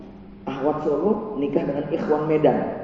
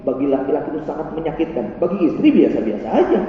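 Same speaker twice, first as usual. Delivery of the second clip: ordinary talk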